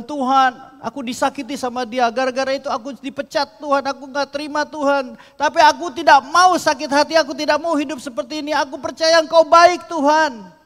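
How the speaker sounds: loud, high, pleading cry to God; pleading prayer. Acted. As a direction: beg God, voice straining high. Acted prayer of hurt believer. (doa memohon dengan suara keras dan tinggi)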